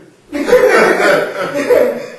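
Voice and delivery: bursts into a loud laugh; real laugh. Real, not acted. Laughing while telling friends her story.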